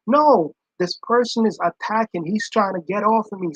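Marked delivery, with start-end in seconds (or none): none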